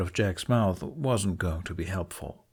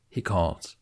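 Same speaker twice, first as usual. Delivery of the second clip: usual speaking voice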